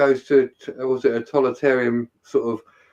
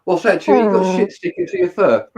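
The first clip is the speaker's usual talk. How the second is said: higher, laughing mock chat to Chewie; playful Star Wars banter; 7 semitones higher, 3 dB louder than usual. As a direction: tease like talking to Chewbacca, pitched up. Mock talk with Chewbacca.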